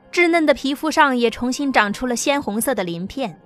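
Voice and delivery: ordinary delivery, nothing marked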